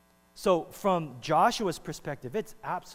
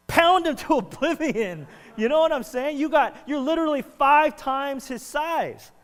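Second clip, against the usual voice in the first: incredulous; 11 semitones higher, 11 dB louder than usual. Real, not acted. Preacher mocks the strategy.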